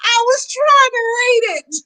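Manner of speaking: high, laughing defence; giggly embarrassed excuse; laughing protest. Real, not acted. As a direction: plead through laughter. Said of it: "I'm so embarrassed".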